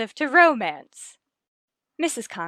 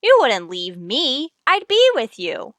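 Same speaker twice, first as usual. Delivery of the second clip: flirtatious; 5 dB louder than usual. Acted. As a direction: tease him with a coy promise. Flirting with her colleague.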